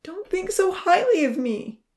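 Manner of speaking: high, loud, anguished plea; on the edge of sobbing. A narrator's reading. Zahir begs friends not to think highly of him, near sobbing. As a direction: plead loudly as the tears break. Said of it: "And then he began to sob".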